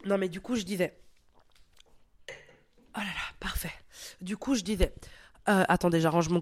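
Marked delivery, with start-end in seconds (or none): 2.3-3.7 soft whispered aside. Real, not acted. Host fixing her headset mid-recording, whispered aside. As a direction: whisper it under your breath.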